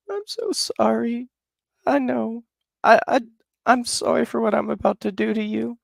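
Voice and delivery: very high put-on voice, mock tearful; mother apologizing to her baby, high mock-crying voice; tearful apology. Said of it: "she starts crying".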